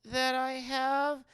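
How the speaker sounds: high, hesitant fluttery voice; flustered aunt voice. Retold or imitated. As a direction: squeak out the awkward hint. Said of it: "But she goes".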